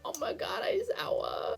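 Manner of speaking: soft, breathy whispered awe; hushed whisper. Real, not acted. Fan overwhelmed by Aizawa's grief, soft whisper.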